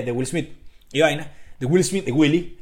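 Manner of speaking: usual speaking voice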